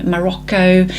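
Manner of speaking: ordinary talk, nothing marked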